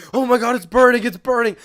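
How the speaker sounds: loud, high-pitched mock panic; freaking out, imitated. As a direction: yelp it out like a friend faking agony. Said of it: "he just starts freaking out".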